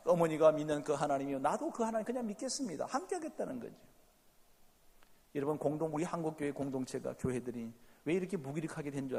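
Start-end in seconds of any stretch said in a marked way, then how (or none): none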